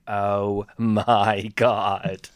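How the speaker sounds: stretched-out, deadpan disbelief; appalled American drag queen. Retold or imitated. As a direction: drag out each word in mock horror. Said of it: "show her entrance and her and be like".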